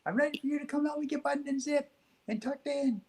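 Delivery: put-on high child voice calling to mom. Little boy calls to his mom from the bedroom, high and bright. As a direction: call out high like a little boy waiting for help. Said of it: "Then try again".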